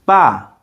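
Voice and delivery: sharply falling "ba"; "ba" like a stern command, falling; fourth tone. Shown as a demonstration. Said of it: "for the fourth tone".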